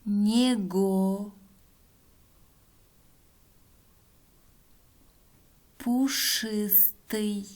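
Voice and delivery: slow word-by-word dictation; dictating. Shown as a demonstration. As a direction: spell each word out slowly for pupils.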